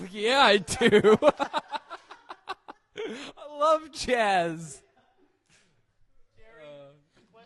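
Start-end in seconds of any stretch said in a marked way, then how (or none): 0.1-4.8 louder, slow and laughing; excited laughing. Pretend. Laughing, gushing to the interviewer about loving jazz.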